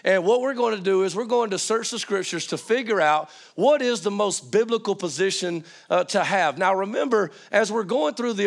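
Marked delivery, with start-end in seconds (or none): none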